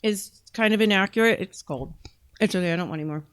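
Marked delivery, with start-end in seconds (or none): 1.4-3.2 talking with her mouth full. Real, not acted. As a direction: say it through a mouthful of food. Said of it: "I'm talking with my mouth full".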